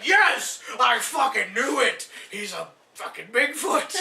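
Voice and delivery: slow, loud, high shout of vindication; triumphant Ventura. Acted. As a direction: shout it out, dragging the words in triumph. Ventura vindicated by flimsy proof.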